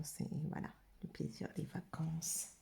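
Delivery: hushed holiday daydream; dreamy whisper. Real, not acted. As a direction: let it fade into a wistful whisper. Dreamy trailing off about holidays.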